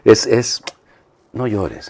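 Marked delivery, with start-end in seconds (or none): 1.3-1.9 soft, tender no llores; quiet, gentle reassurance; consoling. Acted. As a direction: comfort someone who is crying. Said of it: "es una palabra de consuelo".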